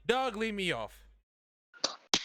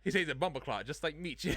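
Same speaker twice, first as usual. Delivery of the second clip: ordinary talk, nothing marked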